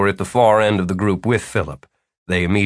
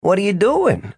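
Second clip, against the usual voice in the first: gentle, puzzled host voice; kindly asking what's wrong; not unkindly; 10 semitones higher than usual. A narrator's reading. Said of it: "not unkindly".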